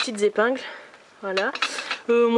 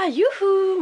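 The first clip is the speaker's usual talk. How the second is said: mock cheer; 9 semitones higher than usual. Ironic cheer at her computer.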